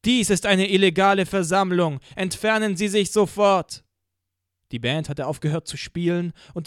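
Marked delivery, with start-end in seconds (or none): 0.0-3.8 loud, higher, official booming order; stern amplified police announcement; police loudspeaker command. Acted. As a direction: announce it like a godlike PA voice.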